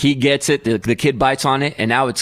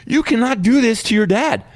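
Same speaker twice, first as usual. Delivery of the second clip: indignant dad; 7 semitones higher than usual. Retold or imitated. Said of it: "I'm like".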